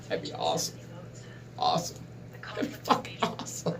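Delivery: hushed dreamy whisper. Real, not acted. Vlogger dreaming of a Klingon girlfriend, whispered. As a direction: breathe it like a secret wish.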